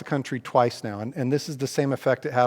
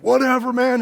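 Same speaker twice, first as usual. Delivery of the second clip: higher, louder brush-off; dismissive stranger; 11 semitones higher, 7 dB louder than usual. Retold or imitated. Stranger brushing him off before taking off in his truck, a dismissive high whatever. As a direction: wave him off like a guy driving away. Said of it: "He's like".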